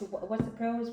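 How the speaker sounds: usual speaking voice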